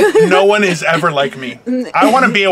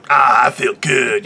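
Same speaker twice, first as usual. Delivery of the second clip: low, slow contented sigh; satisfied diner; 7 semitones lower, slower than usual. Acted. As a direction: sink into it slowly, low and satisfied. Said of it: "come out of there going".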